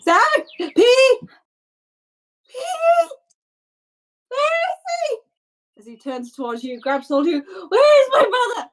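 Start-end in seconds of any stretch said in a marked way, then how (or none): 0.0-5.2 high-pitched frightened calling out; frantic boy. Acted. Game master voices frantic boy shouting. 7.7-8.7 high, loud, fast panicked cry; frantic demand. Acted. Boy grabs player, demanding his brother.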